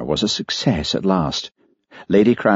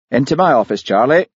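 raised, commanding tone; stern order; 7 semitones higher, 4 dB louder than usual. A narrator's reading. Mister Johnson to Charlie after the scene, stern order. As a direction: summon an employee firmly. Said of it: "ordered Mister Johnson".

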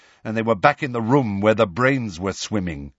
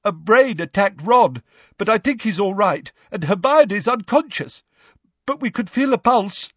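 high, urgent character voice; anxious Neville; 9 semitones higher than usual. A narrator's reading. Neville telling Lupin who is hurt, high and urgent. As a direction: report the injuries in a high, pressing voice.

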